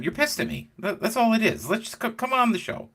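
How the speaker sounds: high-pitched wheedling appeal; retold pleading. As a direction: plead it up high, coaxing them along. Retells pleading with someone today.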